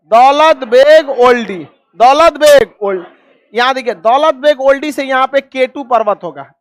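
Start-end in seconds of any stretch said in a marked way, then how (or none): 0.1-3.1 loud, drawn-out name for repetition; loud class drill. Real, not acted. Teacher has students say the airport name, loud drill. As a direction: call it out slowly for the class.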